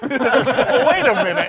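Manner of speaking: slow, loud, laughing. Real, not acted. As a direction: drag it out through laughter. Laughing interruption.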